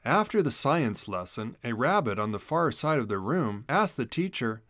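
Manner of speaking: ordinary delivery, nothing marked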